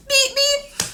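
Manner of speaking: high squeaky beep beep; playful beeping. Retold or imitated. Fan mimicking a playful beep, high and squeaky. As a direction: chirp it like a horn.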